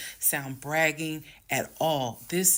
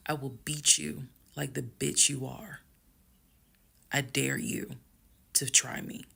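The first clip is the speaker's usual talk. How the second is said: menacing whisper. Retold or imitated. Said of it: "calmly got close to him".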